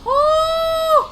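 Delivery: sung-out thrill at a near death; high excited squeal. Real, not acted. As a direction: squeal at the danger on screen. Sees deadly drop.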